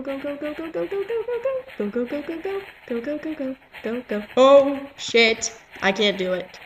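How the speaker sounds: ordinary talk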